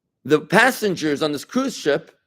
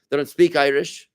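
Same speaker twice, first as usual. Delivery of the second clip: ordinary delivery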